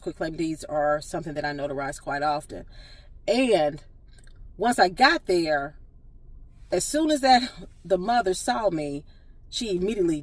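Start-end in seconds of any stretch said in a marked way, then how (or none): none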